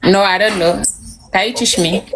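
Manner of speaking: usual speaking voice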